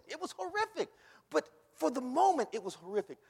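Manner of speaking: loud, high, fervent preaching; whooping preacher in full flight; preaching at full force. Acted.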